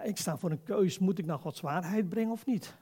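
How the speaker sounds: normal talking voice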